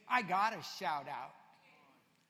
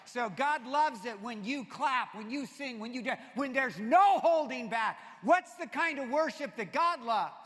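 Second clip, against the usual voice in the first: loud, high preaching call; preaching at full force; 5 semitones higher, 11 dB louder than usual. Acted. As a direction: drive it home to the congregation. Preacher building to a shout.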